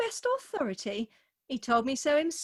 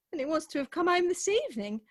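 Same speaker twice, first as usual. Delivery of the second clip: normal talking voice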